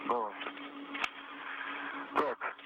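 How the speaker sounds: ordinary talk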